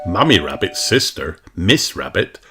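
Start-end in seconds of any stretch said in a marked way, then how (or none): none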